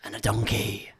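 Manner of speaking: a hint of a horror-film ghoul; creepy survivor. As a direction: tell it like a haunted horror figure. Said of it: "he'd be like".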